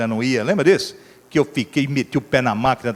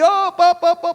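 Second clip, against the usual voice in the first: a high, loud mock horn honk; honking horn imitation; 14 semitones higher, 4 dB louder, slower than usual. Passing car honks at the stranded driver, high mock horn.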